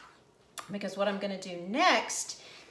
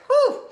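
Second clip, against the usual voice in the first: loud winded exhale, much higher; breathless whew; 14 dB louder than usual. Real, not acted. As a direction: blow out a tired, relieved whew. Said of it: "I'm out of breath and tired".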